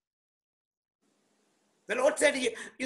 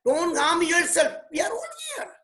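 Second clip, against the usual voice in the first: sudden high shout cracking up on all; 5 semitones higher than usual. Shown as a demonstration. Preacher re-enacts Paul's shout to the jailer, high yell. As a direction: yell it out to stop him, pitch leaping. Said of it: "Paul shouted".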